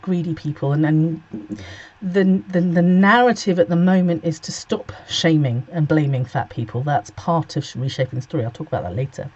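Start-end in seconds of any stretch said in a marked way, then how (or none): none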